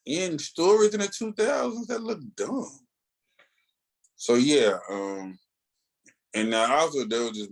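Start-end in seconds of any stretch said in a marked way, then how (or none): 0.1-2.7 high, scoffing verdict; exasperated mockery. Real, not acted. Fan scoffs at dated costumes to cohost, pitched high. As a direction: roll your eyes at it. Mocking outdated look.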